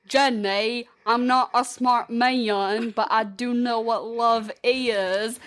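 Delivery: slow, drawn-out film-character drawl; second-hand impression, slowly drawled; Forrest Gump. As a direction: stretch each word in a rough movie impression. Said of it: "does an incredible Forrest Gump".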